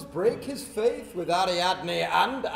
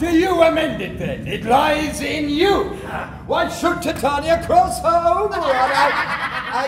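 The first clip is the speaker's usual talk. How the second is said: much higher and louder, laughing scorn; indignant retort; 8 semitones higher, 6 dB louder than usual. Acted. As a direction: hurl the question back at her. King retorts in quarrel.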